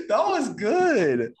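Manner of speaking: high, loud, drawn-out praise; amazed approval, pitch way up; impressed delight. Real, not acted. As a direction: cheer them on, stretching the words slowly. Cheering the accent demo.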